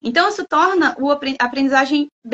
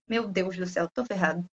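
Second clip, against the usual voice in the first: much lower and softer, defeated; despairing mutter; 8 semitones lower, 11 dB quieter than usual. Retold or imitated. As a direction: mumble it to yourself, giving up. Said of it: "você vai falar assim". (murmúrio desanimado, bem mais baixo)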